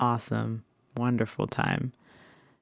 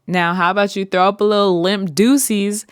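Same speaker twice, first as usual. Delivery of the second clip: louder silly suggestion; louder teasing voice to grandma; playful coaching; 5 semitones higher, 5 dB louder than usual. Retold or imitated. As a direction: egg her on. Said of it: "What was that conversation like?".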